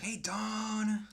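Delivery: high, loud call with a long stretched Dawn; shouting back. Retold or imitated. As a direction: yell the greeting across the lot. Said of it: "And I would shout back".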